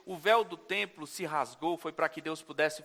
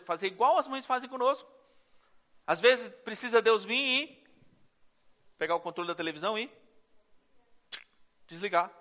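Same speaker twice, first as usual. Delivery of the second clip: normal talking voice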